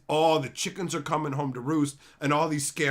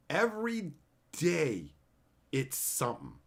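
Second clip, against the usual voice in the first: slow weary repeat; 4 dB quieter than usual. Shown as a demonstration.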